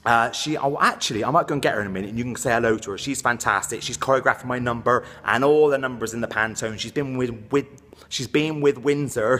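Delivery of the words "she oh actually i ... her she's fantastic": usual speaking voice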